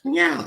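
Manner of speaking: high playful cat meow; mock meow. Streamer mimicking a cat mid-chant, high meow. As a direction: toss in a silly meow.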